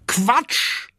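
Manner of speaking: monkey calling out. Acted. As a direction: blurt it out like a cheeky monkey. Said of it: "ruft der Affe". (hoher Affenruf)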